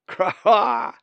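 loud, high raven cackle; cawing laugh. A narrator's reading. Molly laughs mid-tumble, loud cawing laugh. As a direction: laugh it out as a caw. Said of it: "laughed Molly".

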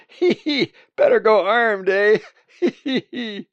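high, loud, giggling hunter; cackling giggle. Acted. Hunter jokes back about going armed, cackling. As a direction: squeal with laughter around the joke.

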